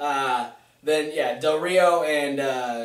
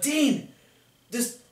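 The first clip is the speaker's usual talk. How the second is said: mock indignant, pitched higher; indignant protest; 5 semitones higher than usual. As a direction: play an affronted show host. Acting out Miz protesting to Dean.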